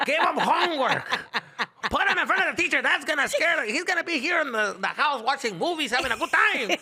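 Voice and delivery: high, loud laughing mock dad; fed-up father ranting, half laughing; voicing dad. Son voicing dad telling school off, loud mock rant.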